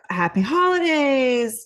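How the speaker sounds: bright, warm holiday wish; cheerful greeting. Shown as a demonstration. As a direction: sing out a friendly hello. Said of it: "Assertive would be".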